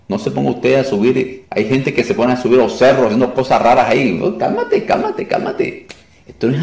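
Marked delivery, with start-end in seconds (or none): 4.1-5.9 mock soothing. Telling eager believers to calm.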